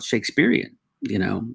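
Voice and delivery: ordinary talk, nothing marked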